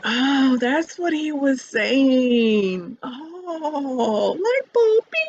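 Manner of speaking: slow, drawn-out oh, rising high into light bulb, bing; dawning realization. Retold or imitated. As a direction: let the penny drop slowly, then pop up bright. Retelling a sudden realization.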